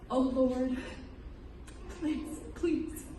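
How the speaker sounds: faint, breaking plea; retold gasped prayer. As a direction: plead softly as if with your last breath. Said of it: "I gasped what I thought might be my last words".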